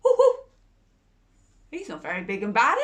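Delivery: ordinary delivery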